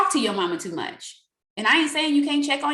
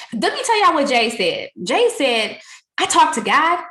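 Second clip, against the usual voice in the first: louder, higher, proud testimony; loud retold quote; 5 semitones higher, 6 dB louder than usual. Retelling a leader's story, louder and higher. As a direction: boast it to the group. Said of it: "she was just like".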